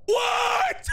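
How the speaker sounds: very high shouted question; high, startled yell; shrieked disbelief. Real, not acted. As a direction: yelp it in shock. Yelling in disbelief.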